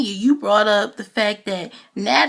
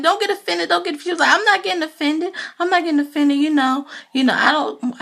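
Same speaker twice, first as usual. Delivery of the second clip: high, louder, defensive mock Portia; imitating Portia; 7 semitones higher, 4 dB louder, faster than usual. Portia protesting to the ladies she isn't offended, high and loud. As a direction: squeak it out like Portia insisting she's fine.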